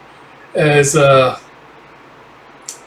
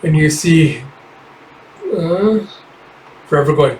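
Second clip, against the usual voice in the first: ordinary talk, nothing marked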